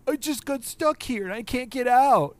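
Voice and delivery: high, loud cartoon wail; mock crying. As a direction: imitate a tearful character wailing he's stuck. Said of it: "walks out of the darkness and he's crying".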